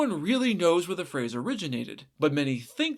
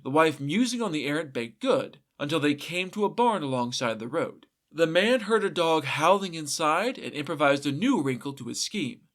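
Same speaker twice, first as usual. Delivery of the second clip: plain reading voice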